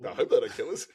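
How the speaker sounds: mock-scared, laughing; secret worry, laughing. Dad admitting fear of orcas, laughing. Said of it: "I'm secretly going".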